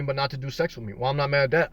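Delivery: normal talking voice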